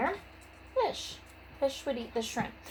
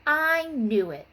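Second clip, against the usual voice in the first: groaning; 7 semitones higher, 5 dB louder, slower than usual. Acted. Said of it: "groaned Arnold".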